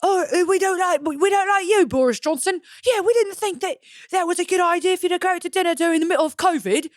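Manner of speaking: put-on overdone posh English accent; mock English accent. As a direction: scold Boris like a proper Brit. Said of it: "what accent was that".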